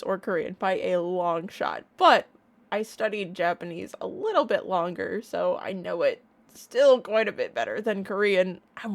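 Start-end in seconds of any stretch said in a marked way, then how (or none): none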